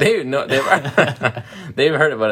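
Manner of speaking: high, loud laughing reply; laughing through words. Real, not acted. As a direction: speak while cracking up. Laughing reply to partner's joke.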